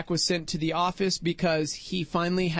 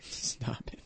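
whispered disbelief; whispering; 7 semitones lower, 12 dB quieter than usual. Real, not acted. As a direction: hush it out in shock. Reacts to prison fight news.

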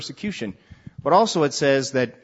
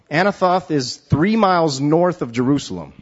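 ordinary delivery

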